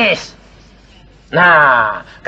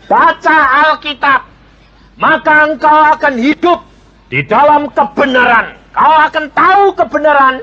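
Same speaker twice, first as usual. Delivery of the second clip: fervent exhortation; 9 semitones higher than usual. Real, not acted. Preacher exhorts congregation.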